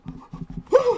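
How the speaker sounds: high shocked gasp; re-enacted gasp. Reviewer remembering his shock at the scene, re-enacted gasp. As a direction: gasp sharply, far above speaking pitch. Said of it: "I remember just being like".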